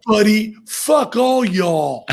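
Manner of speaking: hammed-up American twang, drawn out slowly; Piff's American accent. Imitating a friend's only American phrase, exaggerated American accent. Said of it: "I love Piff's American accent".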